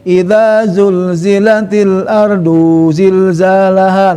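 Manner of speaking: slow, flowing Quranic chant; connected recitation. Shown as a demonstration. Teacher reciting verse joined in flowing chant. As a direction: link the words in one slow chant. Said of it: "Jadi sampai".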